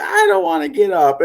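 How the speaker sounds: whiny sleepy daughter. Retold or imitated. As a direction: whine it like a sleepy kid in bed. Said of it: "It's like".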